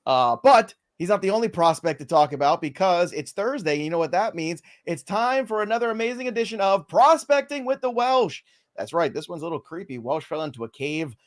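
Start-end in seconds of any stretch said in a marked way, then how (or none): 4.8-8.4 high-pitched show-host intro; game-show style build to the segment title; segment announcer. Pretend.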